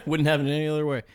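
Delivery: ordinary talk, nothing marked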